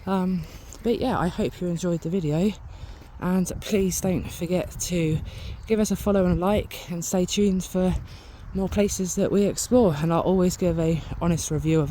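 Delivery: cheerful channel outro, asking for likes; upbeat vlog outro. Acted. Vlog sign-off to viewers.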